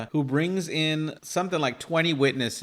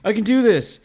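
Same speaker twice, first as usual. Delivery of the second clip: much higher and louder, mock shout; retold angry outburst; 10 semitones higher, 8 dB louder than usual. Gunman overruling his eager lackey, in a loud, high retort. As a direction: shut the lackey up with a raised voice.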